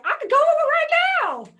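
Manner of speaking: very high, loud eager reply. Retold or imitated. Answering the owner's invitation, very high and eager. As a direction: jump at the chance.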